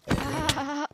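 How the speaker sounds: loud, high "ah" cry; sudden high yelp; startled cry. Real, not acted. Startled in the game.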